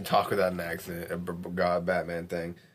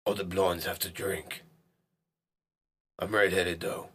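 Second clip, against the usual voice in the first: Batman voice; 2 semitones lower, 4 dB quieter than usual. Acted. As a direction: read it like a brooding vigilante. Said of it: "I gotta talk like Batman".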